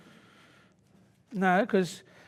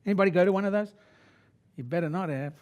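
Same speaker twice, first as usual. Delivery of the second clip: ordinary talk, nothing marked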